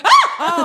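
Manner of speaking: high, loud comic gasp-cry; hammed-up burst of ecstasy; loud fake moan, half laughing; mock climax cry. Mock climax after Irish greeting.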